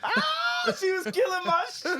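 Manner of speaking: very high, hammed-up wail; mock high cry. Teasing friends about her killing it, high mock shout. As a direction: shoot the pitch right up in mock outcry. Mock outburst over the retold song.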